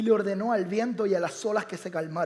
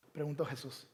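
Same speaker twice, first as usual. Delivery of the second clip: ordinary talk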